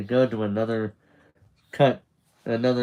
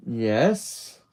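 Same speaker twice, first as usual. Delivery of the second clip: YES with a rising, unsure lilt; tentative "yes?" lifting at the end; questioning. A narrator's reading. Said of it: "He says it like a question".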